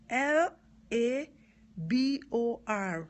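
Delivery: loud, separated letters, L, A, B, O, R; spelling out. Shown as a demonstration. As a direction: spell it slowly and emphatically, letter by letter. Spells the word to clarify accent.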